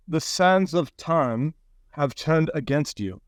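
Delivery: slow, portentous oracle read; slow solemn reading. Acted. Puppet reads the paw inscription slowly and solemnly. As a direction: intone it like a prophecy.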